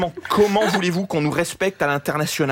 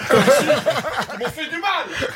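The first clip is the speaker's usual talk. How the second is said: high, drawn-out mock hurt; mock wounded ex; 8 semitones higher, slower than usual. Comic talking of colleagues as exes, mock wounded. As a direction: play the wronged ex, voice pitched way up.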